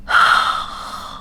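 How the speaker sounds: long breathy outward sigh. Real, not acted. Vlogger about to gush over a book series, long sigh. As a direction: let out a big voiceless sigh.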